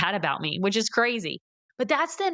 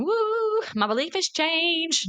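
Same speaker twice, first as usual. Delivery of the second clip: high, drawn-out fake elation; mock celebration; 9 semitones higher, slower than usual. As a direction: pretend it was magically easy, hammed-up. Mocks expecting instant change after one step.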